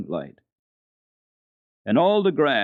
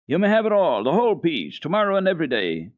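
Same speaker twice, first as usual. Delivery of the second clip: eager offer; 7 semitones higher, 5 dB louder than usual. Acted. Heidi eagerly offers her cheese.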